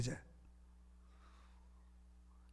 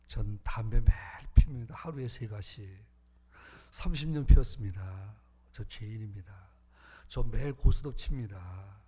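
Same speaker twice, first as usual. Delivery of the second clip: tearful confession; 6 semitones lower than usual. Retold or imitated. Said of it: "막 울다가". (흐느끼듯 낮고 느린 고백)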